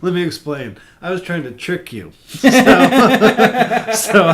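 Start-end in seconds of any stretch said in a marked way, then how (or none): 2.5-3.9 a big, high burst of real laughter; cracking up hard at the confession; loud laugh. Real, not acted. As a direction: laugh out loud at your own joke. Big laugh at own joke.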